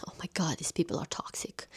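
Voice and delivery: gossipy hushed whisper. Real, not acted. Gossipy aside about the characters.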